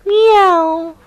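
storybook cat mewing; cat meow. Acted. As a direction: mew like a cat stuck up a tree. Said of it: "she said".

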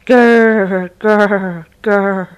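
loud growling grr; dog growl. Acted. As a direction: growl like a big dog warning off. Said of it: "said a big dog".

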